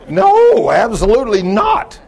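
high, loud, drawn-out "no"; hammed-up indignant denial; emphatic denial. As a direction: imitate her adamant refusal. Woman's emphatic denial, retold.

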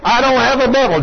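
mild re-enacted screaming denial; retold scream. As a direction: imitate a man protesting at high pitch. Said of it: "Then he starts screaming".

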